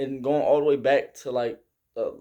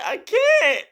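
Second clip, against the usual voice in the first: much higher, strained panicked shout; desperate cry; 6 dB louder than usual. Retold or imitated. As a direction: cry out that you can't breathe. Choking in the gas chamber.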